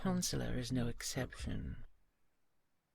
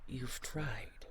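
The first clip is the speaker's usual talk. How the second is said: usual speaking voice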